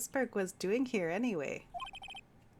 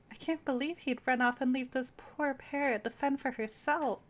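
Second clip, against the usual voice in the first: ordinary delivery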